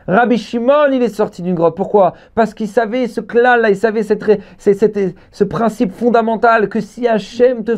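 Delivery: high, insistent sermon storytelling; fervent high-pitched preacher; impassioned preaching. Real, not acted. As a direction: declaim it high, driving the story on. Preaching at full force.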